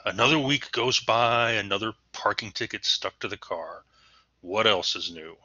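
big sigh, then given-up weariness; resigned, exasperated. Shown as a demonstration. Teacher voicing someone fated to get parking tickets, resigned. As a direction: sigh and give up, a little exasperated. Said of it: "I've just resigned to the fact".

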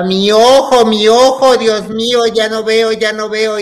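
loud, high retold cries of panic; hammed-up wail, as if blinded; panicked screaming. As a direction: cry out in mock desperation. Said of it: "y yo gritaba".

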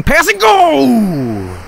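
urging the play on; excited call. Real, not acted. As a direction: stretch the last word out high. Cheering a play on.

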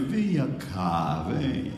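slow, low beckoning; frail sick woman. Retold or imitated. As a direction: feeble call to come closer. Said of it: "com a voz bem longe".

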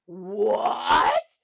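loud, outraged royal shout; king's bellow. A narrator's reading. King told to apologise, bellowing. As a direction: bellow it in disbelief. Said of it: "he bellowed".

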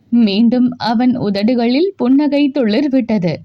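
plain reading voice

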